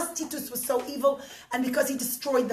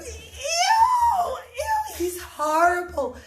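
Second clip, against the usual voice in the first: high, shrieking ew of disgust, then slower; disgusted crowd; 12 semitones higher, 5 dB louder, much slower than usual. Acted. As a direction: squeal in disgust at the king. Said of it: "And everyone's going".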